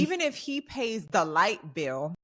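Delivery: ordinary talk, nothing marked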